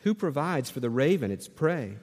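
steady, measured reading of scripture aloud; solemn Bible reading to the congregation. Acted. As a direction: read the passage with weight. Continues reading Job aloud.

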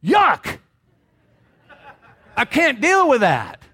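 loud, high mock disgust, laughing at the end; playful loud refusal of collard greens; disgusted yuck. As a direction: shout out a hammed-up yuck, then slow down and laugh. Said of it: "And I said".

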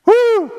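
high, loud calling whoops; farm calling whoop. Shown as a demonstration. Farm boy calling his cows, loud high whoops. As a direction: call the cows in from the field. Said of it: "that's the sound that we use".

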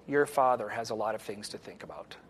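imitating his mom's calm, hushed explanation; mom impression. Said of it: "I'm gonna do my best mom impression".

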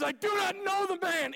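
high, loud desperate shout; shouted denial. Acted. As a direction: hurl the denial out.

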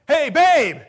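loud, booming mock dad call; hammed-up big-man holler to his wife; dad calling mom. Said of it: "and as deep as voice".

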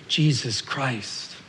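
ordinary delivery

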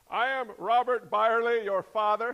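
very loud, slow, pompous announcement; hammed-up booming declaration; mock grand introduction. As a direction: proclaim yourself to your own child like royalty. Mock formal introduction to own daughter.

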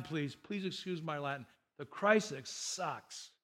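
quiet pleading prayer to Jesus. Acted. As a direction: pray it as someone lost in a crisis. Prays aloud as a struggling believer.

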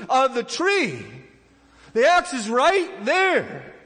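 slow, loud, high-pitched pulpit warning; fiery preaching. Acted. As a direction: thunder it from the pulpit. Preacher at full force.

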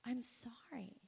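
soft unvoiced whisper; whispered apology. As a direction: whisper it tenderly. God's tender reply.

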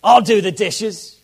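a sharp, loud, reluctant snap; grudging loud snap. Shown as a demonstration. Husband to wife, a loud grudging offer. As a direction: throw it out like a chore forced on you. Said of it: "in a different tone".

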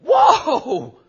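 a loud, astonished whoa; amazed whoa. Retold or imitated. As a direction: gasp in wonder at seeing the light. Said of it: "suddenly you're like".

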